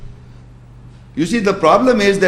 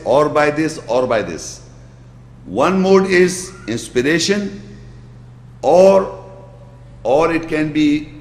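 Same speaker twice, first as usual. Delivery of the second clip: usual speaking voice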